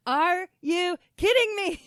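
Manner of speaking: high, loud, drawn-out disbelief; incredulous outburst. Retold or imitated. Child reacts to mom's flat denial, incredulous. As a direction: stretch each word in mock outrage.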